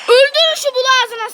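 high, loud order barked at teammates; real angry gamer yell; angry shout. Real, not acted. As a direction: yell it in frustration. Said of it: "Ben niye bağırıyorum".

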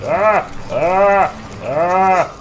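loud high panicked yells; startled shouts. Real, not acted. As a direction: yell out in sudden fright. Spotted by the guard. (cris de panique aigus et forts)

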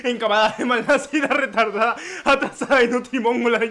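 hammed-up ranting list; climactic mock tirade. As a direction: build the abuse to a loud finish. Insult list reaches its climax.

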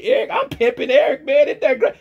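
mock gloating voice of a channel owner; mocking critic. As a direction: ham up their smug voice. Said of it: "you can tell me".

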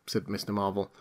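ordinary delivery

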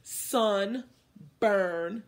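"Sunburn" held long with a rising tune; drawn out word. Shown as a demonstration. Teacher modeling for the class, drawn out and rising. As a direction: stretch "Sunburn" into two parts, rising. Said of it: "Your turn".

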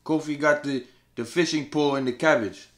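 lightly hammed African accent asking about the cabbage; mock African accent. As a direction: play up a comic African accent for laughs. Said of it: "your African accent you did earlier".

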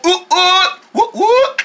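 gleeful high woo-hoos; high party whoops. Acted. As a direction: whoop in celebration. Bugs celebrate.

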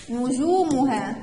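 نجومها said slowly with the long u stretched; drawn-out long vowel. Shown as a demonstration. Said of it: "الواو مش عليها اي حاجة".

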